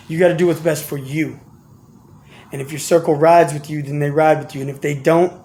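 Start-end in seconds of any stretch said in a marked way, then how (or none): none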